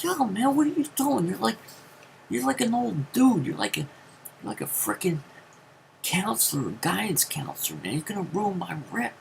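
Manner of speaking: cringe at the uncle's lecture; mortified teen plea. Retold or imitated. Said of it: "I was like".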